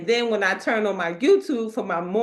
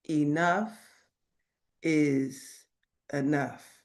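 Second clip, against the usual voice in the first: God's quiet voice; 6 semitones lower, 6 dB quieter, slower than usual. Retold or imitated. God's voice heard in prayer.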